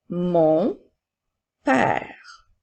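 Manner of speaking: word by word with pauses; careful dictation for pupils to write. Shown as a demonstration. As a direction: dictate slowly, each word set apart. Teacher dictating words to pupils.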